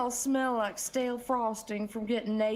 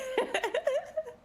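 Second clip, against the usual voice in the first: very high giggle. Acted. Candy remembering the night parties, high giggle. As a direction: giggle helplessly at the memory.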